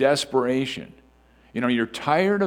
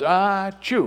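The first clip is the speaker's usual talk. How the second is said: playful imitated sneeze, higher; hammed-up ah-choo; mock sneeze; 8 semitones higher, 4 dB louder than usual. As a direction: act out a quick sneeze. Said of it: "if someone goes".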